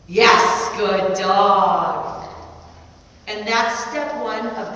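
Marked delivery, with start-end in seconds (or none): 0.1-2.3 louder, happy dog praise. Real, not acted. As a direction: reward him with your voice.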